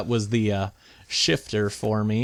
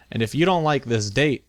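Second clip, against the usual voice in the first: ordinary delivery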